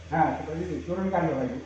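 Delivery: ordinary delivery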